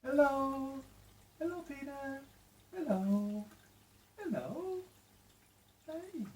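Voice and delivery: put-on high sing-song baby voice for the fish; pet talk. Owner greeting her new fish in high, sing-song pet talk.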